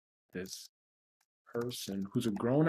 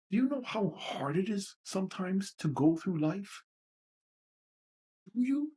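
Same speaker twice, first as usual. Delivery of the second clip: high, pained demand; upset challenge; 5 semitones higher than usual. Real, not acted. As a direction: press the question, pitch climbing.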